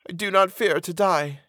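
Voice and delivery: high-pitched, resigned condemned woman; mournful. A narrator's reading. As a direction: lift the voice high, sad and accepting. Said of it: "shook her head mournfully".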